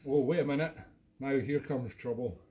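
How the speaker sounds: ordinary delivery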